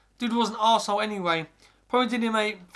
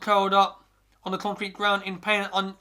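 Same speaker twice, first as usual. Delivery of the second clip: ordinary delivery, nothing marked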